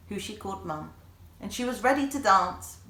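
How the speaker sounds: plain reading voice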